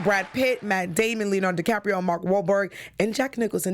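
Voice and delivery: ordinary delivery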